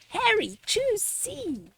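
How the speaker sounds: high, eager call to the character; bright call to a cartoon boy; urging call. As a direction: cheer him toward the right answer. Urging character to pick answer.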